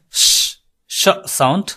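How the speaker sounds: শ drawn out as a hushing sh; slow, hushed sh demo; sh sound shown slowly. Shown as a demonstration.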